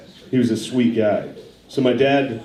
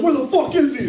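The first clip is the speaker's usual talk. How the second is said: barging-in thug. Retold or imitated. Dad's gang bursts into wrong house.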